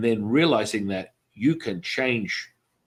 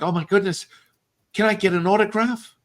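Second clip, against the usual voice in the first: higher, eager fan plea; mildly higher autograph plea; 10 semitones higher than usual. Retold or imitated. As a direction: ask like a starstruck fan. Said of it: "They're like".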